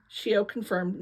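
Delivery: usual speaking voice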